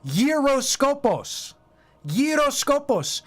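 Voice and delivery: "gyroskopos" said slow in Greek, yee-ro-sko-pos; careful Greek "gyroskopos"; Greek pronunciation. Shown as a demonstration. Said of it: "It comes from the Greek word".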